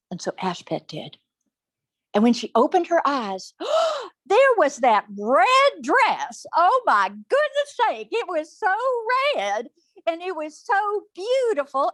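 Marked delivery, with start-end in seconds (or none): 3.6-4.1 sharp, high intake of breath; delighted story-time gasp; amazed gasp. Acted. Ashpet opens eyes, amazed. 4.3-11.9 gushing, high-pitched amazement; awed excitement. A narrator's reading. Narrator marvels at the red dress.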